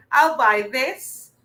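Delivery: ordinary delivery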